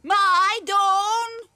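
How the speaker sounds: loud, anguished calling out; distraught cry. Acted. Mother telling the landlady her daughter is gone, loud anguished cry. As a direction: break out in distress.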